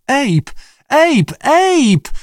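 very high terrified screams of his name; screaming. A narrator's reading. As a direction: scream for him in panic. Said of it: "wrzeszczał koteczek Lee". (przerażony wrzask)

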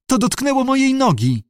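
high-pitched little whimper. A narrator's reading. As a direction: whimper it like a helpless kitten. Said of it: "kwilił koteczek".

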